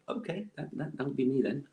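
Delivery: meek acceptance. Retold or imitated. Student answering the vice principal's insult, mock-meek. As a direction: take the insult meekly, voice lifted and light.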